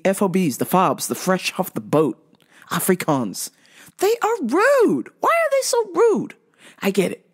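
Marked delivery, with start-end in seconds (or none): 4.0-6.3 exasperated. Real, not acted. Speaker venting about rude customers, high exasperated complaint. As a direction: wail the grievance in disbelief.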